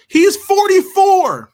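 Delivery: loud, high, drawn-out disbelief; incredulous high-pitched reveal; astonished outburst. Real, not acted. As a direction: blurt it out high and loud, stretching the number. Calls out actor's real age, astonished.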